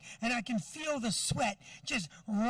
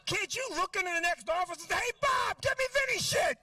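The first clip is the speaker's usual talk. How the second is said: exasperated yelling; 9 semitones higher than usual. Retold or imitated. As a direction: yell it at the unemployment clerk. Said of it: "I'm like".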